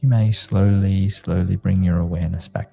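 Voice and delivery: sleep story narrator. Acted. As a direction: soothe them gently into calm. Leads a guided meditation.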